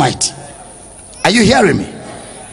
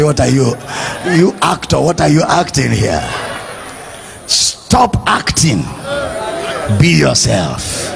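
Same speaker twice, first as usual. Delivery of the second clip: God, amused rebuke; 9 semitones lower, 4 dB quieter than usual. Acted. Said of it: "God will just laugh and say".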